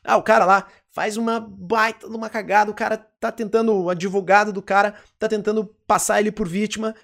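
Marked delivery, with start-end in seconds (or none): none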